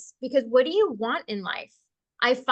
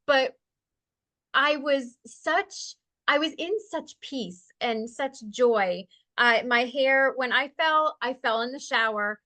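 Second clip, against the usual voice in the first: normal talking voice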